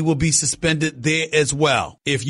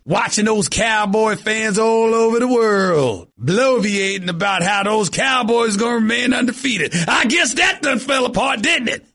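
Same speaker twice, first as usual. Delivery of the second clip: mock Southern Cowboy accent. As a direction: play a boastful Cowboy fan, Southern accent. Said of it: "with my regular Cowboy accent".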